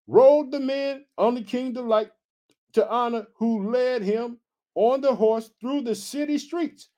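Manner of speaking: slow, high-pitched Bible reading; scripture reading. A narrator's reading. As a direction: stretch out the verse for the congregation. Reads scripture aloud.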